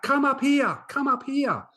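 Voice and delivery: high, loud retold beckoning shout; cool kid calling the new boy up the hill, imitated shout; yelling out. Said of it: "just kept yelling out".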